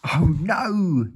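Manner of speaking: Mister Mean gasping about his ears; gasped. A narrator's reading. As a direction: gasp in dismay. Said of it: "he gasped".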